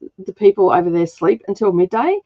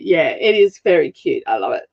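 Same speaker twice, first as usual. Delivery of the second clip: ordinary talk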